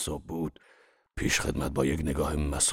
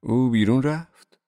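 higher, louder, slow naive question; naively asked; 6 semitones higher, 6 dB louder than usual. Acted. Narrator naively asking Wolfshiem if Rosy went out, higher and louder. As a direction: ask it wide-eyed and innocent. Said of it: "با سادگی پرسیدم".

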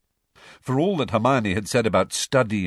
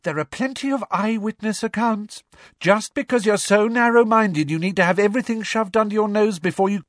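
high, heated rush, getting louder; angry retort; 13 semitones higher, 5 dB louder than usual. Acted. Girl defending her belief against a sceptic, heated. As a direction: argue back furiously until cut off. Angry Luna quarrels back.

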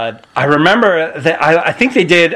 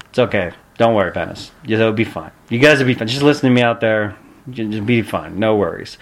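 low, soft imitated reassurance; cool, calm, collected; 5 semitones lower, 4 dB quieter than usual. Veteran opponent calming debuting wrestler, imitated calm. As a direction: settle a nervous rookie, unbothered. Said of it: "cool, calm, collected".

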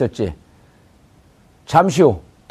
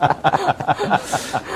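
short laugh; 5 dB quieter than usual. Real, not acted. Host laughs at name joke.

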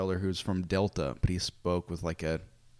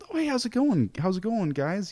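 much higher, bright chirpy door greeting; cheery missionary greeting; 12 semitones higher, 4 dB louder than usual. Retold or imitated. Missionary greeting a family at the door, chirpy and high. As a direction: sing out a sunny hello.

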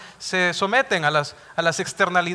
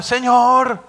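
high, loud, pleading cry to God; mock tearful cry of Israelites weeping at the altar; imitated wailing plea; 10 semitones higher, 9 dB louder, much slower than usual. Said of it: "llegaban ahí al altar a llorar".

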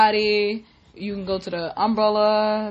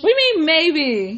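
mock outrage; 6 semitones higher, 8 dB louder than usual. Mom mock-offended at maybe.

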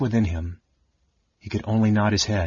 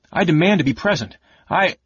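fast, high-pitched protest; shouted demand; 9 semitones higher, 4 dB louder than usual. A narrator's reading. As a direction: demand it urgently, breaking off abruptly. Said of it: "Caramon shouted".

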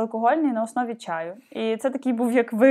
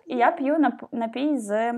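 normal talking voice

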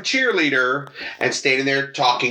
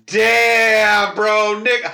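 louder, higher impressed damn; impressed exclamation; 6 semitones higher, 5 dB louder, much slower than usual. Retold or imitated. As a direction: cheer it in awe. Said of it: "The other part of me is going".

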